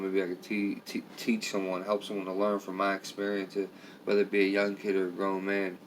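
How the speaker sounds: usual speaking voice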